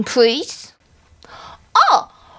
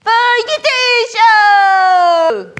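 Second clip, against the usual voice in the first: The Yeti Show drawn out loud and slow; slow loud title; 7 semitones higher, 5 dB louder, much slower than usual. Acted. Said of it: "show title announced".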